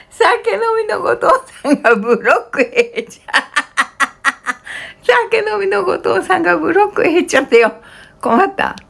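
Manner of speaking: high, laughing while talking. Real, not acted. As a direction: say it through laughter. Reads viewer name, laughing.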